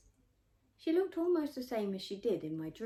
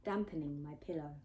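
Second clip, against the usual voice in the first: plain reading voice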